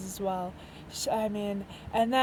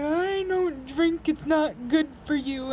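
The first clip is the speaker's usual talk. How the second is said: higher, slow, hammed-up preachy dad; mock dad voice; 5 semitones higher, 4 dB louder, slower than usual. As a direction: imitate a prim dad refusing alcohol, drawn out. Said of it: "so he's like".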